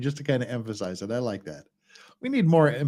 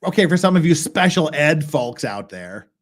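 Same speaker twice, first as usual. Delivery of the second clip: normal talking voice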